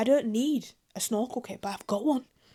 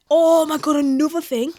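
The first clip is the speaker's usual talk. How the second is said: excited; 5 semitones higher, 11 dB louder than usual. Real, not acted. Excited new topic burst.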